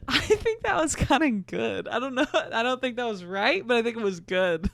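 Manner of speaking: high, giggly self-review; laughing. Real, not acted. Reader judging her French attempt, laughing. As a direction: talk through your own giggles.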